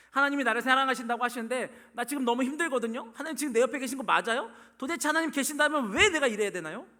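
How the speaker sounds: loud, high, aggrieved protest; arguing complaint. Acted. Struggling believer confronting God, accusing protest. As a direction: press the complaint harder with each question. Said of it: "라고 따지는 거죠".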